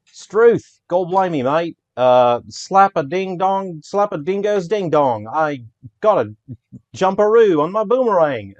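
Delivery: put-on overdone jokey Australian accent, slowed right down. Developer joking about the Opera House, loud mock Australian accent. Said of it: "I should be doing the Australian accent".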